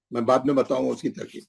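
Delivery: ordinary delivery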